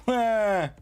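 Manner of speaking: playful screaming. Real, not acted. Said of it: "I don't know why I am screaming".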